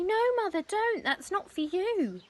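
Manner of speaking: high, urgent plea to mother; anxious pleading. Acted. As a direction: beg her to stop. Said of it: "cried Missus Cranky".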